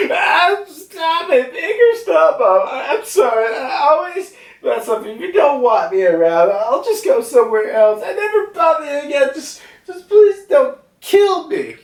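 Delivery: high-pitched, tearful cartoon pleading; hammed-up whimpering for mercy; frantic begging. Zeus begs Mama not to kill him, frantic begging.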